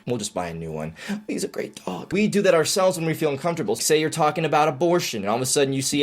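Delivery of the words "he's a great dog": higher, much softer soothing; mock consoling. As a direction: pat them and reassure.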